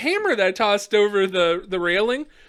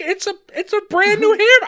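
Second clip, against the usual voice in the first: high, loud pleading; imitated indignant whine; mock protest; 12 semitones higher, 6 dB louder than usual. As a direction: argue desperately for a prized tool. Retold protest to cop.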